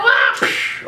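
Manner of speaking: high falsetto yelp, then whoosh; jump kick noises. Pretend. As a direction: play out a kick with mouth sounds.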